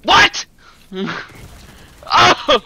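sharp high loud yelp; jolted out of you; shocked. Real, not acted. Streamer caught off guard by the game, yelping.

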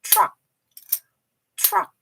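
truck as one short blended beat; one syllable. Shown as a demonstration. Teacher models for children, truck in one syllable. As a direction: fit all of truck into a single sound. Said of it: "한 음으로 넣어 주셔야 돼요".

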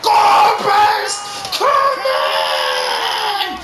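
screaming, high and loud; ecstatic shout. Real, not acted. Gamer screaming as his striker scores, ecstatic shout. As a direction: roar him on to the goal. His player shoots for goal.

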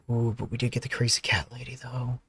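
soft unvoiced whisper. Real, not acted. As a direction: whisper it like a secret. Whispered aside.

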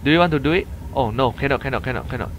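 ordinary talk, nothing marked

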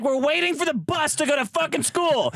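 high, hectoring demand; hammed-up exasperated hustle for money; mock angry rant.

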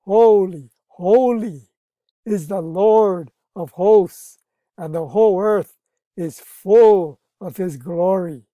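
loud, slow proclaiming of the angels' cry; preaching scripture. Acted. Pastor proclaiming the angels' praise to the congregation, loud and slow. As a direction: ring it out like a preacher reading Isaiah.

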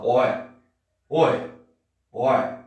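"oi" said loudly as "oe"; aggressive final E. Shown as a demonstration. Guy confronting someone nearby, aggressive "oi" ending in E. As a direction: bark "oi" with an E ending, once each. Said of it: "mais agressivo ainda".

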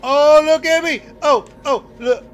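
high, loud showing-off cry; excited shout. Real, not acted. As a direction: crow with delight at yourself. Celebrating a move in game.

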